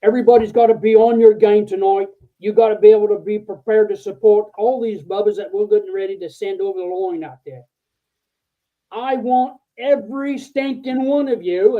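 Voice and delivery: put-on rally the whole staff in a rough Australian accent; bad Australian accent. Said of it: "I'm gonna do a really bad accent".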